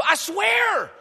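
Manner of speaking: exaggerated pleading I swear; mock earnest oath. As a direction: swear it like you mean it, mockingly.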